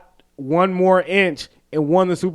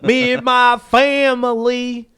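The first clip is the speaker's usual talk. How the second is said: high, slow, hammed-up Southern drawl; Brian Kelly's fake country accent; 5 semitones higher, slower than usual. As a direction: stretch it out in a badly faked Southern accent. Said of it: "he put on that fake country accent".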